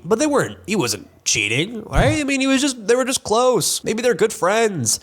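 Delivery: high-pitched, insistent defending; high, incredulous protest on someone's behalf; protesting defence. Real, not acted. Mock-defending a coach accused of cheating.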